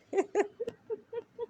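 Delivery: high, light giggle. Real, not acted. Speaker amused at her sister's childhood question, giggling. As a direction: break into a high-pitched laugh.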